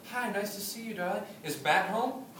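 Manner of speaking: a hint of a charming visitor; sweet Jesus voice. Jesus greeting the wife at the door, lightly sweet. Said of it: "He'll go".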